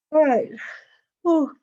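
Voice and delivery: said through a yawn. Real, not acted. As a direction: stretch it out mid-yawn. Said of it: "sorry for yawning at you already".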